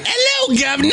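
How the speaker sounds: very high squeaky Cockney greeting with a laugh; joking fake Cockney echo; mock Cockney. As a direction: tease a friend by parroting his fake Cockney. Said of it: "Did you say".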